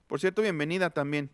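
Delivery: ordinary talk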